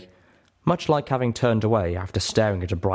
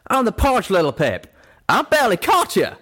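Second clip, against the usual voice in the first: loud, high angry scolding; Calamity snapping; 6 dB louder than usual. A narrator's reading. Friend who barely caught Little Pip, snapping. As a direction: scold forcefully, pitch jumping up. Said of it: "Calamity snapped".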